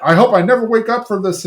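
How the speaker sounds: high, quick imitation of rapturous commentators; mock gushing. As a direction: gush with hammed-up delight.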